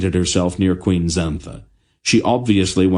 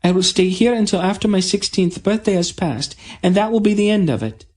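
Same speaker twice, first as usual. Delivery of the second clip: defiant announcement; 14 semitones higher than usual. A narrator's reading. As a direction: plant your feet and refuse. Princess insists.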